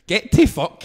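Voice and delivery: sudden re-enacted snap of defiance; retold defiant outburst. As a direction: tell the guard where to go. Retold defiance to security guard.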